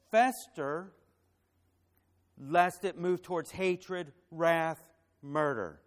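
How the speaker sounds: loud, ringing preacher's voice, slow on the warnings; preaching at full force. Acted. Pastor warns the church against anger, preaching loudly. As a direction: hammer each warning word home to the congregation.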